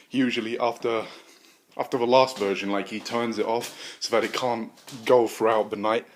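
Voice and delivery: ordinary delivery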